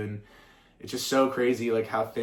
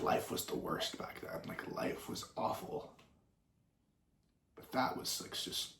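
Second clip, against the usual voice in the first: hushed sad whisper; 13 dB quieter than usual. Real, not acted. Sad memory, voice drops away.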